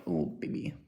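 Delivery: mock couple cooing in a whisper; mushy whisper. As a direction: murmur it like a lovesick sap. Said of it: "But some people are like".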